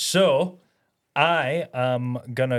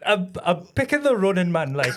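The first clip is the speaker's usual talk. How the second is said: put-on slightly raised Geordie accent; 4 semitones higher than usual. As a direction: try the Geordie pick again. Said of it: "in a Geordie accent".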